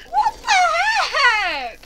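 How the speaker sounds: high, slow, drawn-out disbelief; outraged what the heck. Real, not acted. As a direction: stretch it out, high and loud. Outraged at failed jump.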